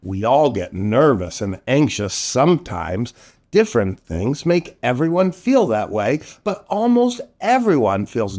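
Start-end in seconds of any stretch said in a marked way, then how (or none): none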